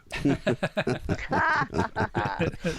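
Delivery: real burst of laughter; hearty laugh. Real, not acted. Reacts to joke about sunny day.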